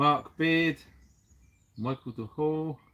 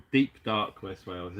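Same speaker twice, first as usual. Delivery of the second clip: mock ominous; 7 semitones lower than usual. Spooky joke about West Wales.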